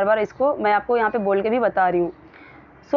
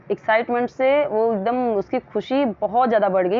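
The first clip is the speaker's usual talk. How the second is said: ordinary delivery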